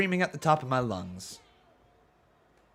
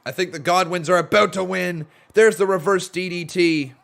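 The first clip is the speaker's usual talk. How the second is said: louder, higher live match call; rising excitement as the pin nears; play-by-play commentary; 5 semitones higher, 6 dB louder than usual. Acted. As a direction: call the finish like a wrestling announcer.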